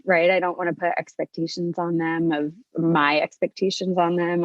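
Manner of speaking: normal talking voice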